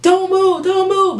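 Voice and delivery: high, loud yelling command; cop yelling. Retold or imitated. Cop yelling at him to freeze, loud and high. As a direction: bark it like a cop with a gun drawn. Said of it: "she's like".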